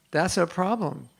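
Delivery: ordinary delivery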